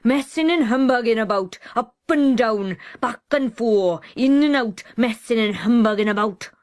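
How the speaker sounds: loud, bullying scolding; a bullying brother scolding his sister loudly; loud hectoring. Acted. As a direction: berate her from the foot of the stairs. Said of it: "The loud, hectoring voice went on".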